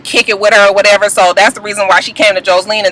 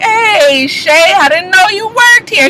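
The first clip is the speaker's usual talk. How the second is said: lightly mocking, higher, drawn-out hello; imitated greeting; 7 semitones higher, slower than usual. Imitating a man greeting the bartender, drawn-out mock surprise. Voicing [name] greeting [name].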